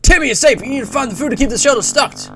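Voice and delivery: high-pitched American announcer; hammed-up American instructor; American accent. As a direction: proclaim it like a Cold War American film. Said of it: "like, an American voice".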